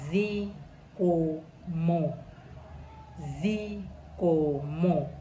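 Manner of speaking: zikomo drawn out very slowly; teacher slows down the word; slow Chichewa word. Shown as a demonstration. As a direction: stretch zikomo for learners to copy. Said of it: "Say it like".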